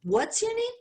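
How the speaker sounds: put-on high, squinting old man's question; lightly voiced crusty old man, puzzled; old man asking. Old man at the register asks her name, puzzled.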